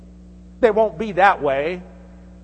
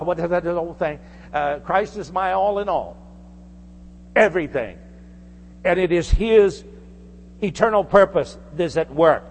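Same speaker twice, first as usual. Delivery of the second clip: normal talking voice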